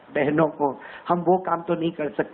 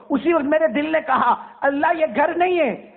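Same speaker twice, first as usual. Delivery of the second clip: heartfelt exclamation; 10 semitones higher than usual. Acted. Preacher voices his heart's amazement at the emptied houses. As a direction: let the heart cry out in awe.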